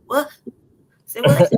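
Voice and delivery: high, loud "Say what?"; shocked disbelief at hearing about coyotes. Real, not acted. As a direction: blurt it out in disbelief.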